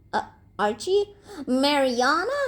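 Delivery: high, rising questions; startled, upturned query; surprised recognition. A narrator's reading. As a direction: ask in puzzled disbelief. Beezus surprised to recognise visitors.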